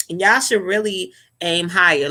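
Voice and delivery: ordinary delivery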